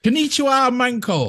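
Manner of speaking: high-pitched, jokey call of hello; playful mock greeting. Playfully trying the word as greeting.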